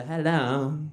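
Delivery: lilting imitation of a foreign-sounding accent; vaguely European lilt. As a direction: greet with a mock European lilt. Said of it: "a little bit of the lilt".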